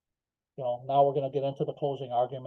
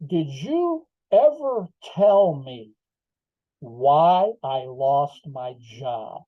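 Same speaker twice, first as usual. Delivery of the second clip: very slow, drawn-out, emphatic question; each word spaced out and pressed home, louder; deliberate courtroom questioning; 5 dB louder, much slower than usual. Retold or imitated. Teller re-enacts questioning the superintendent on the stand, deliberate.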